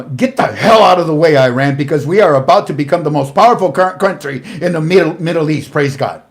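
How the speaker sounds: loud, fast mock proclamation; proclaiming loudly. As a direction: announce it like a triumphant statement. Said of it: "this is what we think it said".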